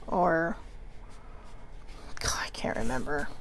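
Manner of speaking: whispered aside to herself. Real, not acted. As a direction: breathe it to yourself. Muttering to herself.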